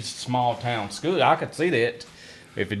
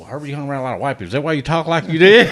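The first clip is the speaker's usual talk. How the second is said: imitated teasing; 3 semitones higher, 7 dB louder, faster than usual. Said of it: "a lot of get teased".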